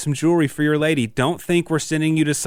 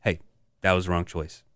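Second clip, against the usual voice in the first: softer, lower gentle apology; apologetic parent; 6 semitones lower, 5 dB quieter than usual. Retold or imitated. Parent apologizing gently to a child, softer and lower. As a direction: talk it down softly like saying sorry to a kid. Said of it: "you have to go and be like".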